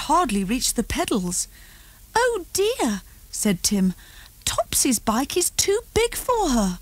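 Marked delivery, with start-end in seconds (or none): 2.2-3.0 put-on child voice. A narrator's reading. Child voice in read story. 4.4-6.8 put-on child voice. A narrator's reading. Tim voiced by narrator.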